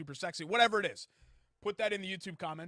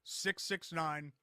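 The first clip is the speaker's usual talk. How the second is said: slow, drawn-out digits, last louder; slow number read; 4 dB louder, slower than usual. Shown as a demonstration. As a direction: spell the number out slowly for listeners. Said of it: "that number one last time".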